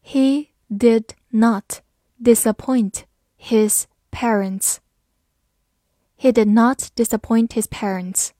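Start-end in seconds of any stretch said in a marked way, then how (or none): 0.0-4.8 slow, clear, each word apart; slow reading. Shown as a demonstration. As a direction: read it slowly for students. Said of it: "好，慢慢来读". (慢速朗读)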